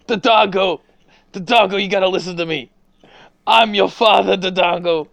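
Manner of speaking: loud, high pleading dying father; overacted death scene. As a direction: beg with your last breath. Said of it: "voicing the dying father".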